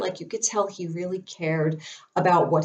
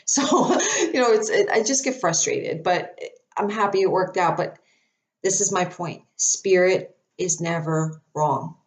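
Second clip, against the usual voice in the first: normal talking voice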